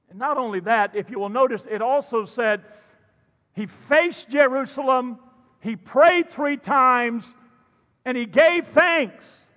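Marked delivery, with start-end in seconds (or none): 3.5-9.4 louder, slower rising emphasis; preaching build. Acted. Pastor pressing the text on the congregation, building. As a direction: climb toward the point.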